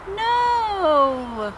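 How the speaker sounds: a sudden high gasped no; shocked cry. Acted. Hearing her old master died, shocked high no. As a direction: blurt it out in disbelief. Listener shocked by news of death.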